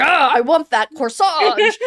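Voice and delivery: loud, drawn-out greedy growl of wanting; aggressive. Retold or imitated. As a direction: imitate someone aggressively devouring. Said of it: "he's, like, aggressively eating".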